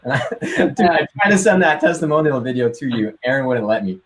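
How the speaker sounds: words bubbling with real laughter, pitch jumping up; laughing as he tells the host about the video; laughing through it. Real, not acted. Recalls the video for the host, laughing.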